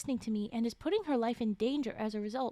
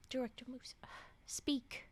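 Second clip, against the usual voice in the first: sighing whisper, softer; 7 dB quieter than usual. Acted. Urging the director to speak, sighing whisper. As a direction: hush it out after a sigh.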